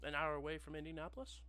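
polished phone rep voice; customer service voice. Acted. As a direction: slick help line operator. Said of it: "familiar customer service voice".

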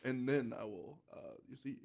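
halting slow-cadence politician impression; Obama. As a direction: imitate a measured presidential cadence. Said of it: "he has that slow cadence".

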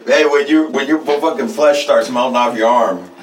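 loud, heated rant; angry rant. Real, not acted. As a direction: tear into him loudly. Ranting about the guru.

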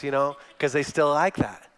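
usual speaking voice

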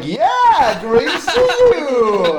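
high, slow, stretched-out American drawl. Retold or imitated. Hassan back from Houston greeting colleague, hammed-up American accent. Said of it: "rednecky American".

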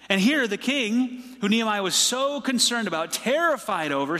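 ordinary talk

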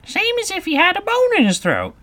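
very high, loud Griffin; Griffin character voice. Acted.

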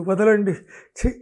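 frantic flustered protest; struggling, flustered protest. A narrator's reading. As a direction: push him off while flustered. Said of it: "ఉక్కిరి బిక్కిరవుతూ".

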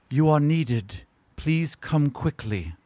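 distant summoning voice, slow; man doing a woman's voice. Acted. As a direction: call him urgently from afar. GM voices Lady Silverhand calling.

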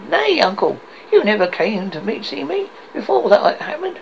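high, lively reproach; protesting nephew. Acted. Nephew asks why Scrooge will not come, high protest. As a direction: reason with him, pitched well up. Nephew argues with his uncle.

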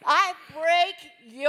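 forceful prayer declaration. Real, not acted. Woman praying against the devil, forceful declaration. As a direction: address the devil with firm authority.

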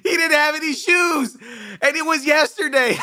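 very high, loud, shrieking cackle; hammed-up top-of-the-lungs laughing fit; annoying relative's guffaw. Drunk relative reacting to a joke, screeching laughter. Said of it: "they bust out with one of these".

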